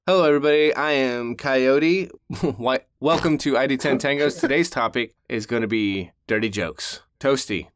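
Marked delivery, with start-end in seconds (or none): 0.1-4.4 show host intro. Acted. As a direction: announce yourself like a show host.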